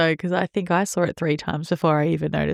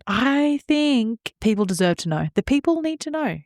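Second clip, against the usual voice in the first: mock grand proclamation; 8 semitones higher than usual. Real, not acted.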